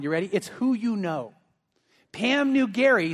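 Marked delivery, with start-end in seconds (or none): 0.3-1.3 "who" punched, slow; stress on who. Real, not acted. Pastor landing the sermon point, "who" stressed. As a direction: lean hard on "who". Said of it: "here's where I'm going".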